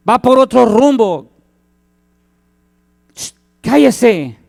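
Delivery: irritated onlookers; scolding crowd. Retold or imitated. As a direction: brush the beggar off. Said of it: "dice que muchos".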